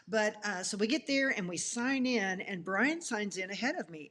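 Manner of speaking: ordinary delivery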